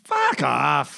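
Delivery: playful Canadian "fuck off"; a friendly Canadian "fuck off"; Canadian joking swear. Shown as a demonstration. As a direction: toss off "fuck off" as a joke. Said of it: "the change in our pitch when we swear".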